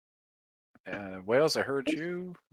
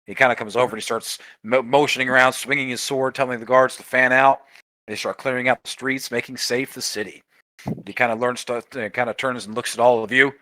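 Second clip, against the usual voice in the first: normal talking voice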